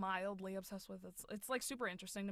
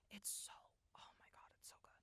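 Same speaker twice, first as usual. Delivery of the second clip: awed whisper; 18 dB quieter, faster than usual. Real, not acted. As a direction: voice sinking into whispered gushing. Overwhelmed praise of the show.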